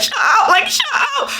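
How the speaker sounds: high, laughing cry of shut up; scream-laugh at the screen; laughing frustrated yell. Retold or imitated. Frustrated outburst at movie.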